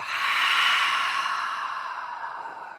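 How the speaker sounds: a slow, breathy exhale out through the mouth; long audible sigh. Shown as a demonstration. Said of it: "sigh it away".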